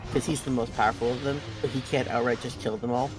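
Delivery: ordinary talk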